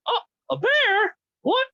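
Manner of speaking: very high, slow squawky parrot cry; parrot imitation. Owner imitating her parrot's startled cry, very high. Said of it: "It mimics phone calls".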